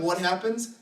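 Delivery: usual speaking voice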